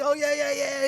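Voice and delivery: high, hammed-up moan of delight; mock food moan. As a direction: savor it like a foodie. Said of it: "eat it while I'm like".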